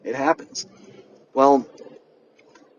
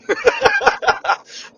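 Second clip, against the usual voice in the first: high burst of laughter; real laugh; 4 dB louder than usual. Real, not acted. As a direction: laugh out loud at your own joke. Laughs at own story.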